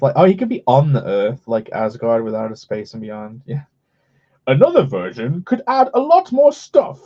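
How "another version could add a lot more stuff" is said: excited. Acted. Mock pitch of versions.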